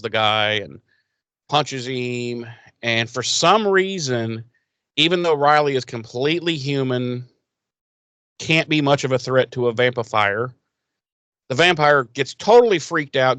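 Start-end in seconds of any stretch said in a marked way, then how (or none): none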